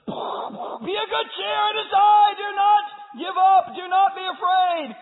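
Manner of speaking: very high, loud, slow call; commanding shout. Acted. Jesus calls out over the storm to the disciples, shouting. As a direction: shout courage across the storm.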